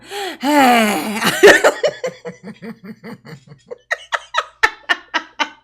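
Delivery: long hammed-up sigh with a laugh in it; hammed-up sigh, overplaying the emotion; exaggerated mock sigh. Shown as a demonstration. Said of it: "you can tell by my sigh that I'm very emotional".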